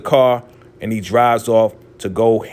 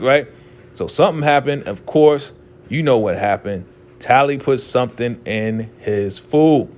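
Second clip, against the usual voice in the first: ordinary delivery, nothing marked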